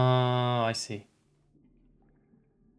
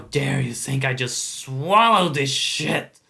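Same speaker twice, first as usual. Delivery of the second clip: angry raspy spectre; 5 dB louder than usual. Acted. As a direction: threaten him until he's screaming. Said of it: "when I do this guy's voice".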